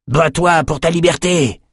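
high-pitched rallying cry; urged cry. Acted. Rebel handing a veteran a sword, crying out. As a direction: cry it out to spur a fighter. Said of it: "cria un type".

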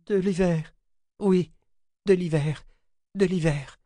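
high, repetitive delirious voice; entranced woman, pitched high; deranged babbling. A narrator's reading. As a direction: chant it high in short bursts. Deranged sister babbles incoherently.

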